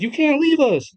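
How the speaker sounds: put-on high childlike plea; little girl pleading. Clementine begging Molly not to leave, high child voice. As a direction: beg like a small kid left behind. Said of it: "Clementine says".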